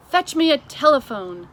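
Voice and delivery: commanding. A narrator's reading. The queen orders Mister Tibbs, loud and commanding. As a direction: snap the order out to the butler. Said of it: "the queen commanded".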